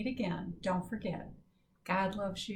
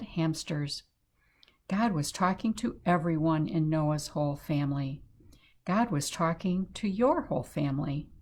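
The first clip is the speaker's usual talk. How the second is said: storytime reading; 7 dB louder than usual. Acted. Missus B reading the Noah story aloud to the kids, warm storytime narration. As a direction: lively, clear read-aloud for young children.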